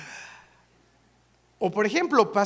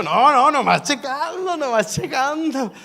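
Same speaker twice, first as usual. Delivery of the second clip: nervous cover-up; 6 semitones higher, 7 dB louder, slower than usual. Retold or imitated.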